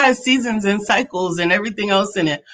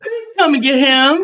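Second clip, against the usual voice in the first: playful exasperation; 7 semitones higher than usual. Real, not acted. Mock quarrel on air.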